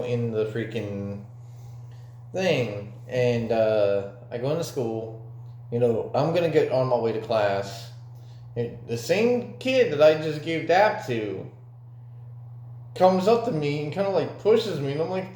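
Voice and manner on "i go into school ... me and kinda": normal talking voice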